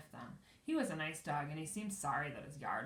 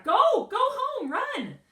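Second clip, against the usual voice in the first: panicked shout; 18 dB louder, much slower than usual. A narrator's reading. Frightened neighbor shouting.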